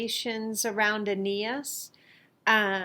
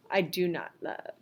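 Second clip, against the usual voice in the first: ordinary talk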